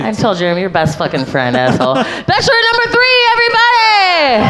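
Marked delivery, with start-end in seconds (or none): 1.9-4.5 high shouted game show intro; booming pumped announcer. Acted. Host hyping third bachelorette.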